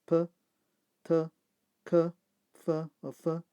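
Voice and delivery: p, t, k, f as separate short sounds; voiceless sounds. Shown as a demonstration. Teacher listing voiceless endings to learners, isolated consonant sounds. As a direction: sound out each consonant alone, with pauses between. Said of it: "the voiceless sounds of".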